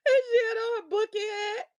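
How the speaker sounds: crying-laughing retelling; laughing while talking. Real, not acted. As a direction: push words through tears of laughter.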